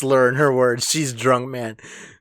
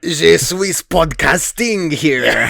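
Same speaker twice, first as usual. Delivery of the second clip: slow, hammed-up French accent with a laughing shout; joking French accent; mock French accent; 4 semitones higher, slower than usual. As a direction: ham up a French accent, drawn out. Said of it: "French accents".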